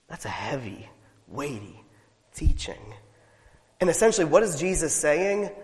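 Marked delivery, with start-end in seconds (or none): 0.1-2.9 hushed solemn. Real, not acted. Sober reflection.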